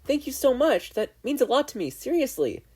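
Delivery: very high, loud gushing gratitude; overflowing with thanks. A narrator's reading. Girl thanking the boy for boots, gushing.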